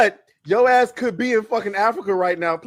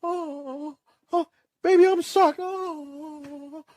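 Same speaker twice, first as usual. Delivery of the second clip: mock moaning; 3 semitones higher, 9 dB quieter, much slower than usual. Host jokingly acts out the other way, mock moaning. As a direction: lightly fake a breathy swoon.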